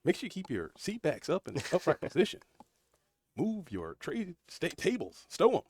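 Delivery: playful cabin-crew safety spiel; mock in-flight instructions; flight announcement. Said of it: "I feel like a pilot, dude".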